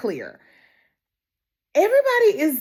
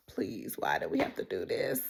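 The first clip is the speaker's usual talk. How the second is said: hushed, breathy whisper begging; whispered plea; 12 dB quieter than usual. Retold or imitated. Fan whispering her plea at the horse at the grave. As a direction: whisper it like a prayer to the screen. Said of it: "I was like".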